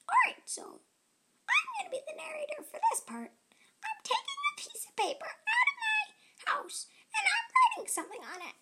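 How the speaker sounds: very high, fast, laughing ant; giggly ant voice. Acted. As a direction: tease the listener as a giggling ant. Ant voice narrates itself.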